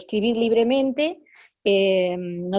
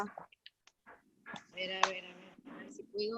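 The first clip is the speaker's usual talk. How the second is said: very faint, far off the mic; phone too far from the mouth; phone held away; 2 semitones lower, 17 dB quieter, slower than usual. Real, not acted. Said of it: "O acércate el teléfono".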